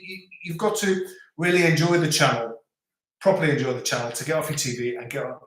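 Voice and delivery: usual speaking voice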